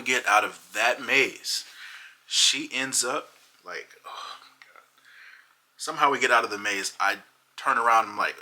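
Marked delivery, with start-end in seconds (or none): 4.0-4.8 faint dazed whisper. Retold or imitated. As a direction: barely voice it, wiped out. Girlfriend reacting after the maze.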